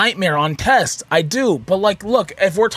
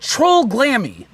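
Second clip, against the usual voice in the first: air quotes; 6 semitones higher, much slower than usual. Real, not acted. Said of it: "quote unquote".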